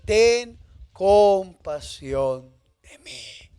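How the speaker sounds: drawn out very slowly, pleading; slow plea. Acted. Blind man begs Jesus for mercy, very slowly. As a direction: stretch every word of the plea. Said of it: "Jesús lo conoce por su nombre y le dice".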